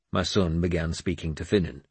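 ordinary delivery

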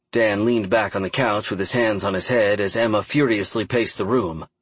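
usual speaking voice

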